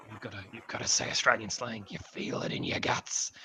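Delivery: hushed, breathy rasp, heavy with melodrama; Samuel Johnson raspy voice. Retold or imitated. Critic mocking an actor's melodramatic narration, whispered raspy voice. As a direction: overblown raspy ad-narration impression, almost all whisper. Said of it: "kind of pioneered this raspy voice".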